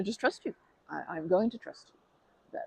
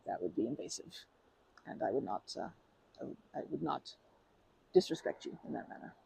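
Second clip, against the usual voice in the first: much lower and softer, almost murmured; soft reassurance; 4 semitones lower, 7 dB quieter than usual. Real, not acted. Speaker promises the river-watcher she never stares, softly. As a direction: hush it out, earnestly reassuring.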